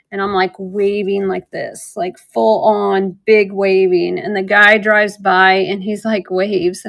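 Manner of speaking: normal talking voice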